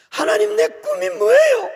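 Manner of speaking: desperate loud cry; anguished shout. Retold or imitated. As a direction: scream the question upward. Shouting his question to God.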